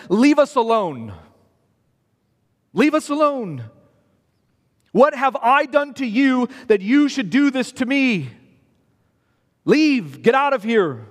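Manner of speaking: a hostile demon snapping leave us alone. Acted. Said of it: "There's great hostility in that question".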